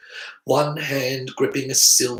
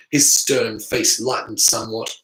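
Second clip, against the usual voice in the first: ordinary delivery, nothing marked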